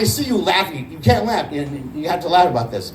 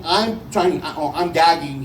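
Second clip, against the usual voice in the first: ordinary talk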